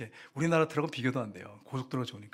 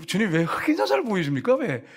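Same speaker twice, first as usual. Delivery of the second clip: loud, raised cry of protest; protesting prayer; 6 semitones higher, 6 dB louder than usual. Retold or imitated. Wife protests to the Lord, raised and pleading. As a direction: demand an answer from the Lord.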